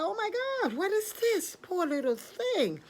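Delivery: very high astonished exclamation, slowing down; startled pity. Acted. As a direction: gasp over a poor fallen creature. Obatala finds the fallen bird.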